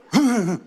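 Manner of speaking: short grunt instead of a laugh; stifle the laugh into a grunt. Shown as a demonstration. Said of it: "a grunt, eh?".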